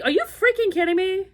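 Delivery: high, incredulous retold outburst; exasperated disbelief. Retelling the cold Ohio trip, pitched high in disbelief. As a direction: throw it up in mock outrage at the weather. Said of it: "I was like".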